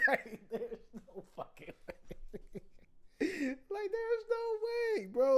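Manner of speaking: slow, breathless words through laughter; laughing helplessly. Real, not acted. Streamer telling chat about the widower, wheezing with laughter. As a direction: squeeze the words out while laughing.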